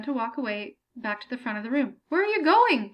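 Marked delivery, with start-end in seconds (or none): 2.1-2.9 tight, higher, louder demand; strained call after the leaving grader; through gritted teeth. A narrator's reading. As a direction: hold back the pain and fury. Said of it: "Benjamin said between gritted teeth".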